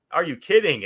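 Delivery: mock excited double take; excited disbelief. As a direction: throw it out in sudden disbelief. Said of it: "then he's like".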